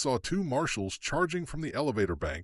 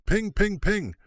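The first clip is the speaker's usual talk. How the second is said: ping; 9 semitones higher, 4 dB louder, slower than usual. A narrator's reading. Bullets ricochet, narrator voices sound.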